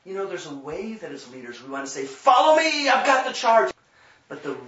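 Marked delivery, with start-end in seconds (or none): 2.0-3.7 loud, high rallying cry; commanding shout. Retold or imitated. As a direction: bark it like a bossy leader. Leaders' bossy rallying call.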